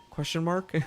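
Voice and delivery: ordinary talk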